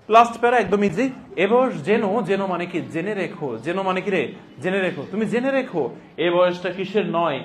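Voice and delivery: ordinary talk, nothing marked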